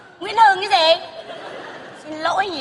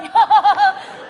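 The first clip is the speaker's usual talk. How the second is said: delighted mother's laughter; gleeful laugh; 2 semitones higher than usual. Acted. Mother laughs gleefully over her promised swimming pool. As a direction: laugh with open glee.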